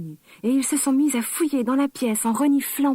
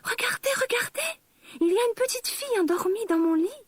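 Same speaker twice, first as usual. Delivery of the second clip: much higher, tiny excited cub calling out; baby bear's tiny cry; 12 semitones higher than usual. Acted. Baby bear finds a girl asleep in his bed, tiny high cry. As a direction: squeal it like a tiny bear who found someone. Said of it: "a crié bébé ours avec sa toute petite voix".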